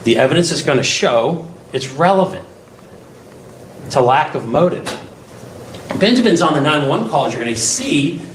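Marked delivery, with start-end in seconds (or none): none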